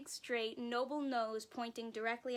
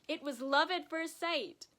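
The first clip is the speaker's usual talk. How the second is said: higher and louder, full of delight; joyful exclamation; 6 semitones higher, 6 dB louder than usual. Acted. Narrator revealing the father-daughter bond, joyful and loud. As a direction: announce it with wonder. Climax of story moment.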